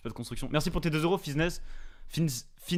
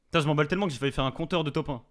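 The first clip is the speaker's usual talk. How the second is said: normal talking voice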